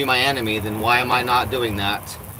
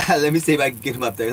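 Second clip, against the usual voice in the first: laughing; faster than usual. Real, not acted.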